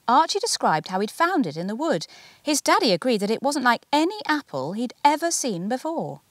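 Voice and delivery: plain reading voice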